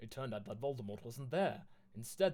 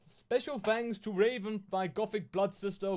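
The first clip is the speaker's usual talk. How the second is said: put-on higher, louder valley-girl author read; mock author voice; 7 semitones higher, 6 dB louder than usual. Streamer reading the author's note to viewers, mock teen-girl voice. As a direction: ham up the teen author gushing.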